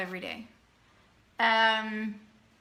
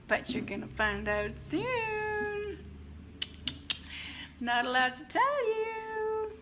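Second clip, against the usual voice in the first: teasing secret; 10 semitones higher, 5 dB quieter, slower than usual. Real, not acted.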